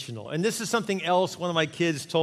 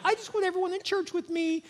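pitched way up, a sweet pleading mom; mock mom voice. Pastor imitating a mom on Mother's Day, high and pleading. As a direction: play the mom who wants everyone beside her. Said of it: "Mom's like".

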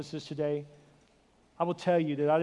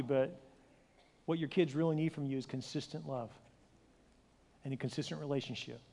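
usual speaking voice

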